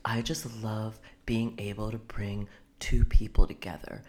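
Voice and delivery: low, slow, breathy-sounding seduction; sultry voice. Retold or imitated. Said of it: "weird sultry voice".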